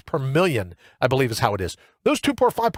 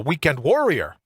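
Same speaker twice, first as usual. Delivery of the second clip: usual speaking voice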